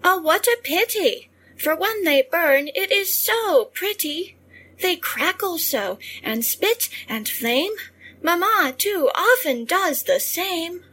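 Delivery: put-on a touch of a thrilled little girl; Harriet. A narrator's reading. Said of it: "But Harriet said".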